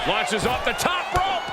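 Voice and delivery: wrestling commentator. Real, not acted. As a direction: build the hype on each move. Play-by-play of high spots.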